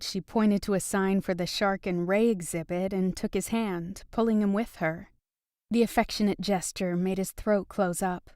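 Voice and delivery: ordinary delivery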